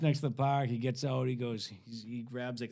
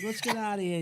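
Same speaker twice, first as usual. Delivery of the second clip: higher, livelier retold invitation; imitated urging; 9 semitones higher than usual. Old hippie coaxing a teen away, imitated urging. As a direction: pitch it up, imitating an eager friend. Older friend urges teen to leave.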